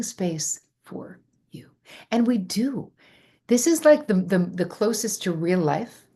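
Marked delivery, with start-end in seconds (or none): 0.0-1.7 slow, breathy whisper of comfort; hushed and tender; soft whisper. Real, not acted. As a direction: whisper it like a promise. Tender reassurance to viewer.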